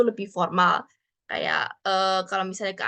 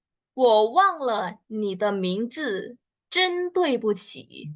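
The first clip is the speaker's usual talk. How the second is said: slow clear model sentence; much slower than usual. Shown as a demonstration. Teacher models the formal apology slowly for learners. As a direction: read it slowly, lift the apology. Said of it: "contohnya gimana nih".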